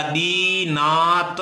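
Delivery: दीनात stretched out as dee, naa, ta; दीनात sounded out piece by piece; slow syllable breakdown. Shown as a demonstration. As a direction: draw out each syllable of दीनात very slowly. Teacher spells out the extracted word.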